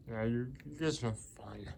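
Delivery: usual speaking voice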